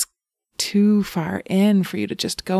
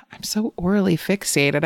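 ordinary talk

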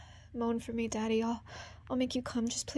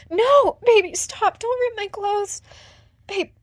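much higher, louder distressed cries; frantic protest; 8 semitones higher, 9 dB louder, slower than usual. Acted. Woman crying out at her partner in panic, loud and high. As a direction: cry out in alarm, slowing on the last line.

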